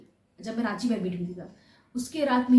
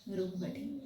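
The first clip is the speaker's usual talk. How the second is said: ordinary talk, nothing marked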